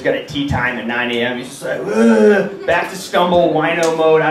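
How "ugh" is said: mock hungover "ugh"; exaggerated morning-after groan. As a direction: groan like a wrecked drunk. Hungover brother gets up.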